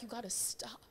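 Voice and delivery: whispered stern warning; dad's whisper. Retold or imitated. Dad telling her to stop the accent, whispered. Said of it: "my dad was like".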